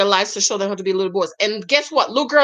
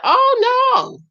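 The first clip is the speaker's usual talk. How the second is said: high-pitched emphatic mock refusal; retold fatherly veto, pitched way up; dad refusing; 11 semitones higher, 4 dB louder, slower than usual. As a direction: lay down the law in a high, exaggerated voice. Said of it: "And his dad said".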